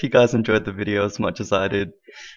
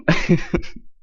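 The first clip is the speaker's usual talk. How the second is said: short, high burst of laughter; real breathless laughter; 8 semitones higher than usual. Real, not acted. Host trying to wrap up, breathless laughter. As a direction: crack up before the sentence gets going. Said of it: "I'm out of breath from laughing".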